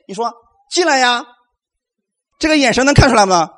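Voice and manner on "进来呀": higher, casual "进来呀" tossed off with contempt; dismissive. Shown as a demonstration. Believer answering Goliath's taunts, scornful invitation. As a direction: shrug off the enemy, come on in then. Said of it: "就是不屑一顾的意思".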